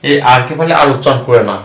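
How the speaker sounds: ordinary talk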